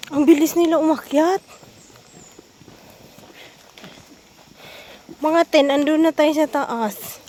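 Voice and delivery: loud outdoor calling. Real, not acted. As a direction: raise the voice over the wind. Shouting uphill on a climb. (malakas na pagtawag)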